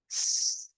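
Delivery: soft long snake hiss; drawn-out s like a snake. Shown as a demonstration. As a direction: let the air hiss out slowly. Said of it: "make a hiss sound like snake".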